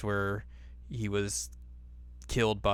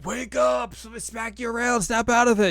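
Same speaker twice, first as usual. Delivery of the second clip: much higher, hammed-up shout to wake him; mock yelling; 13 semitones higher, 4 dB louder than usual. Inuyasha yelling at dazed Moroku, hammed-up high shout. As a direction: screech at him to snap out of it. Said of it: "And he's like".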